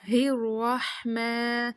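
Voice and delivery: الرحمن with a throaty ح and heavy ر; slow الرحمن from mid-throat; clear haa. Shown as a demonstration. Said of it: "ہا کو کلیئر ادا کرنا ہے". (الرحمن میں حلقی حا)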